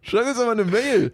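high, bright pleading to listeners; eager appeal. Acted. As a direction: egg the listeners on to write in. Hosts pleading for mail, raised pitch.

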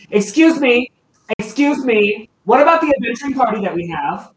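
high, loud busybody voice; a shrill-free but loud, high interrupting villager; pushy townsperson. Acted. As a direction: call out over the crowd, high and insistent.

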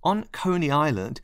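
high-pitched angry outburst; exploding. A narrator's reading. Lionel turns on his pleading admirer, exploding. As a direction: burst out in exasperation. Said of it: "he exploded".

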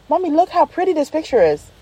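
put-on high little-kid voice; proud child. Daughter shows mom her wall drawing, childlike.

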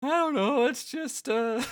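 high-pitched, hesitant mumbling reply; flustered. Acted. Rindo fumbles after being praised, flustered.

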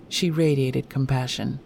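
plain reading voice

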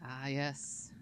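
normal talking voice

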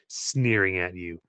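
ordinary talk

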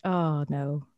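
usual speaking voice

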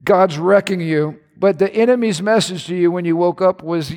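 ordinary talk, nothing marked

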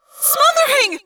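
high, strained cry; smothering panic. A narrator's reading. Rachel suffocating inside the creature, strained high cry. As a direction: gasp it out as if suffocating.

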